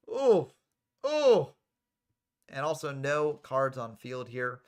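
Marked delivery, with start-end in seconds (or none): 0.1-1.5 high, loud, short cries of oh; shocked ohs. Real, not acted. Commentator reacting to a brutal move, high shocked ohs. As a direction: yelp each oh high and loud.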